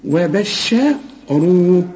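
وبشروه drawn out very slowly, heavy راء; very slow recitation. Shown as a demonstration. Teacher repeats the word slowly for the student. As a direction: recite وبشروه stretched long. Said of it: "قول لي".

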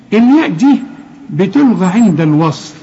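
ordinary talk, nothing marked

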